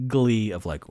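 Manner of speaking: usual speaking voice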